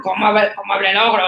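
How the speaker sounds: a hint of a big ogre; mild ogre voice for children. Shown as a demonstration. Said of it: "cambios de código o cambios de entonación".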